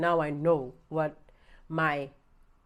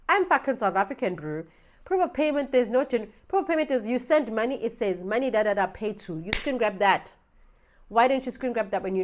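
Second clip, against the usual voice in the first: loud, high exasperated rant; angry rant; 5 semitones higher, 7 dB louder than usual. Real, not acted. Complaining to viewers about the scam, loud exasperated rant. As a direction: vent at the scammer's nonsense, loud. Ranting about fake proof of payment.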